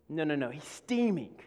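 normal talking voice

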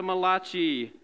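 Malachi as "muh-LAH-chee"; joke Italian pronunciation. Shown as a demonstration. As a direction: give Malachi a mock-Italian "chee" ending, stress on LAH. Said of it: "the Italian prophet".

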